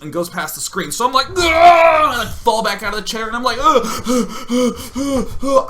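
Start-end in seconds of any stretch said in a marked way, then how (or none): 1.3-1.4 startled yell. Retold or imitated. Gamer startled by a passing wasp, startled yell. 3.6-5.7 high, loud panicked gasps; acted-out yelps of fright; frightened gasps. Retelling fear of the wasp, frightened gasps. I'm so scared.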